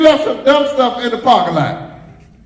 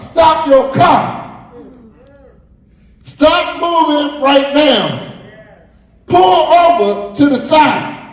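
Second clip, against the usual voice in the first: slow, higher shout of warning; wisdom yelling; 6 semitones higher, slower than usual. Acted. Wisdom yells at passing drivers to pull over, slow shouted command. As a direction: yell at the driver to stop. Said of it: "it's yelling out".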